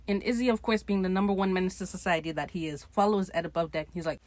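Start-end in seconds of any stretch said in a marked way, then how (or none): none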